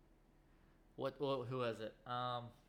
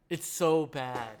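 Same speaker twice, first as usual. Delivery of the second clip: slow, louder, dragged-out so bad; drawn-out disgust; 4 semitones higher, 6 dB louder, slower than usual. Real, not acted. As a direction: stretch it out in mock despair. Repeating verdict on bad movie.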